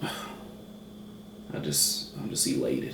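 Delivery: hushed, breathy mock tears; mock emotional. Reviewer overcome by catfish, mock-tearful and hushed. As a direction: play overcome by the food, softly. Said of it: "I'm getting emotional".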